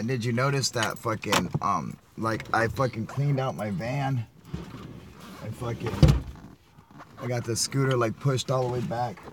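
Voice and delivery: ordinary talk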